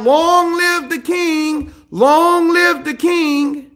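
high, drawn-out mock cheer; hammed-up herald's cry; yelled acclamation. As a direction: hail the king in a slow, high call. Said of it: "he yells out".

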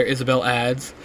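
ordinary delivery, nothing marked

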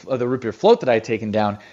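ordinary delivery

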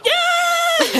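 very high squeal of joy; delighted kid cheer; excited cheer. Acted.

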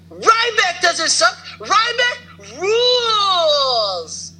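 high, slow, drawn-out fanboy chant; hammed-up fan cheer. Cheering for Ryback in a drawn-out fanboy voice. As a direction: stretch it out like a superfan.

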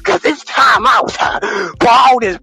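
mock preaching. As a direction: whip the congregation up like a fired-up preacher.

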